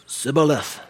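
"Sibboleth" mispronounced, a hissed s replacing the sh. Acted. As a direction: say "Sibboleth" opening on a plain S, no H sound. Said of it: "He couldn't pronounce his S H's".

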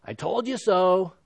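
lightly voiced gloating; a hint of smug reproach; mock told-you-so. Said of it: "a look of".

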